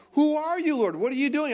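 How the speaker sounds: high-pitched acted questioning; startled Saul. Acted reply of Saul to God.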